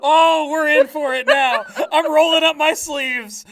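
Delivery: loud, high, thrilled; excited. Real, not acted. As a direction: relish the challenge out loud.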